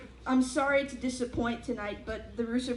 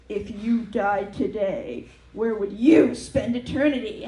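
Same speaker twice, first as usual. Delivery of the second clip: booming preacher voice; 2 semitones lower, 9 dB louder than usual. Retold or imitated. Quoting religious billboard.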